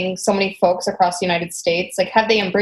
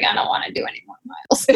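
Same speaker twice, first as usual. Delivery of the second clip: whispered, soft under the breath; mock whisper; 5 dB quieter, faster than usual. Runner whispering her wish to stop after three miles. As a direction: breathe it out like a tired secret. Quoting herself quitting a run.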